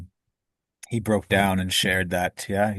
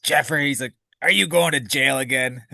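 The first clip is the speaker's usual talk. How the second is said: higher, irritated mock father; imitating annoyed dad; 5 semitones higher than usual. Father answering his son at the door, annoyed and dismissive. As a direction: toss it off like a fed-up father. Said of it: "he's, like, annoyed".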